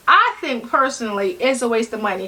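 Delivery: ordinary talk, nothing marked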